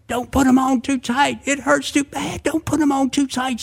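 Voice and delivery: high-pitched pained protest; acted yelling. A narrator's reading. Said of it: "he yelled".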